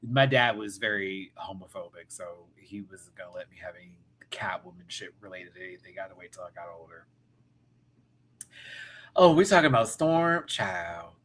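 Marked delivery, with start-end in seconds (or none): 2.1-7.0 hushed, fast whisper; quiet confessional aside. Real, not acted. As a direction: drop to a secret whisper. Confiding about homophobic dad.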